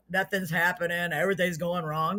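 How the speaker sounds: subdued mock complaining; mock gloom. Host mimics negative people for viewers, mock gloom. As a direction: moan softly like a pessimist. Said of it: "Life is too short to be all".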